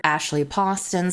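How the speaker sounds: ordinary talk, nothing marked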